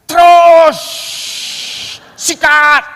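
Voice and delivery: high, loud yell to push on; shouting go. Retold or imitated. Anton on the back seat shouting at the driver to go. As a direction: bark the order to floor it. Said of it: "dia udah teriak".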